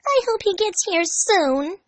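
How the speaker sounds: put-on high, slow girlish longing; high slow impatient girl voice; impatient Amy. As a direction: sigh it out impatiently as a young girl. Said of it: "Amy said impatiently".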